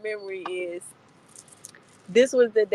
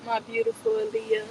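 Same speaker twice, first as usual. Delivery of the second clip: adoring coo; 12 semitones higher, 5 dB quieter than usual. Real, not acted. Adoring a photo of her friend.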